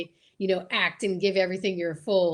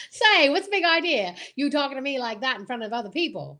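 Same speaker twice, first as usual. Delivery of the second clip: higher, sassy 1940s film dame; old-time dame; 3 semitones higher than usual. Acted. Talking back to the bossy old-time husband, sassy dame voice. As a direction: snap back like a screwball heroine.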